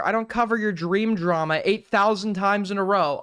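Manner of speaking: normal talking voice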